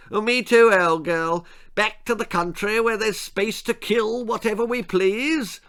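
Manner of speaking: high, pompous plummy voice; posh huntsman. Acted. Lord Percy agreeing with his wife about leaving. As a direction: agree heartily and smugly.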